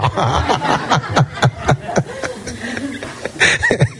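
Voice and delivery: long, high-pitched laugh. Real, not acted. Preacher tickled by his own joke, laughing. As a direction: burst out laughing and keep going.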